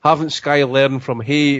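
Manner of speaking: usual speaking voice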